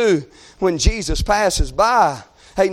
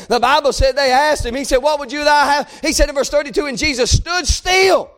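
fervent, rising scripture proclamation; climactic preaching; 5 semitones higher than usual. Real, not acted.

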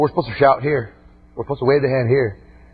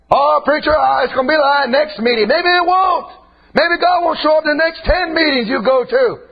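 full-force preaching; 9 semitones higher than usual. Acted. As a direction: hammer every line home from the pulpit.

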